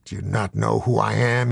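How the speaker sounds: ordinary delivery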